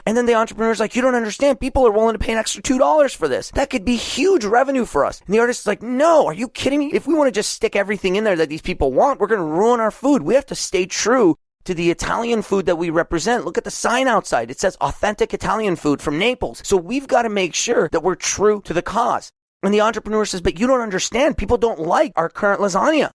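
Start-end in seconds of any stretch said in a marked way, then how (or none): 0.9-5.2 higher, urgent entrepreneur pushing his partner; eager pleading. Acted. Acted quarrel, entrepreneur pleading. 5.8-19.3 higher, offended protest over the recipe; a touch of outraged chef, defending tradition; indignant artist. Acted. Acted quarrel, artist objecting. 20.4-23.1 higher, urgent entrepreneur pushing his partner; eager pleading. Acted. Entrepreneur pushing back in quarrel.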